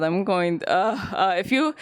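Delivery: ordinary talk, nothing marked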